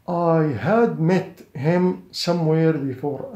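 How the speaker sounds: very slow, word by word; slow model sentence. Shown as a demonstration. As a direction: spell it out slowly for learners. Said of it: "سأستخدم الماضي التام". (جملة نموذجية ببطء شديد)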